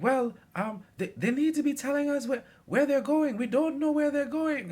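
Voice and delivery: put-on high, loud indignant old lady voice; complaining old lady. Bus passenger scolding the driver, high indignant old lady. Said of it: "so they're like".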